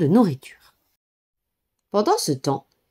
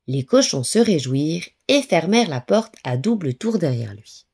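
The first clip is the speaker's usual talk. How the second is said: usual speaking voice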